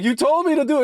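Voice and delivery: high indignant squeak; squeaky mock protest. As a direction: squeal it like a wronged fool. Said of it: "he's like".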